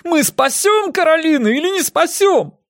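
very high frantic demand; desperate high-pitched yell; howling. A narrator's reading. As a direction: wail for a decision. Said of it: "завыл Эрик".

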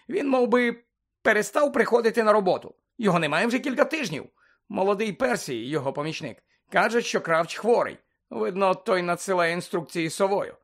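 high, loud, blustering official; flustered man explaining himself; agitated Bagman. Acted.

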